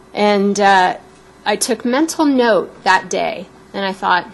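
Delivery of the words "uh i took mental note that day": ordinary talk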